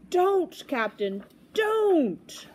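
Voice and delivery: high, loud, drawn-out don't; desperate plea. Acted. Boy tries stopping the captain, desperate. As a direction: beg him to stop.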